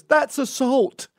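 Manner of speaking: high, loud, slow indignant mock accusation; mocking the woman. The woman accusing him at her shirt touch, high loud mock outrage. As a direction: drag it out in outraged parody. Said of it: "she goes".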